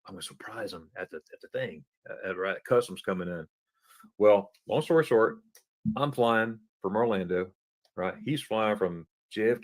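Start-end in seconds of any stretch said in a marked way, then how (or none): 0.1-3.5 inner thought. Retold or imitated. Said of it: "and in my mind".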